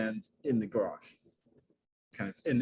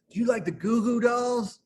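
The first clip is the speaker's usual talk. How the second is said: imitating the shop guy; 11 semitones higher, 6 dB louder than usual. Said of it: "he's like".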